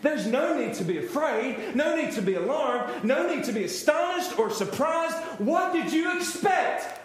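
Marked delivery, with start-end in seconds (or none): 5.4-7.0 raised, teasing what did you expect; amused, high question with a laugh; playful mock question. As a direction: tease the disciples with a grin. Playful voicing of Jesus.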